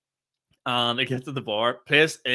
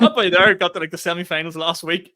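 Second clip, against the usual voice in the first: louder, higher, eager blurt; excited retelling; 5 semitones higher, 7 dB louder than usual. Retold or imitated. Lads reminding him of last week, eager. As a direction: relay the news with excitement. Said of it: "they're going".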